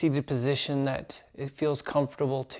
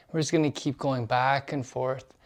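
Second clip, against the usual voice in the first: ordinary talk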